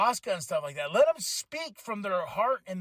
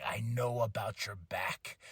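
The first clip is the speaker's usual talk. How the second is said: quiet menacing quote; 8 semitones lower, 8 dB quieter, slower than usual. Retold or imitated. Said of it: "he's like".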